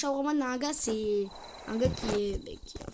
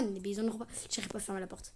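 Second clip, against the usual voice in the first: normal talking voice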